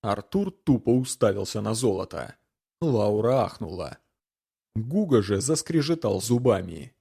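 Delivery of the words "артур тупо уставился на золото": usual speaking voice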